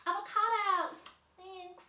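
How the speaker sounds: high, drawn-out avocado; mock avocado voice. Avocado reference voice.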